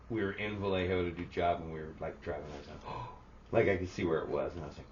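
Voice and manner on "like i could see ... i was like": ordinary talk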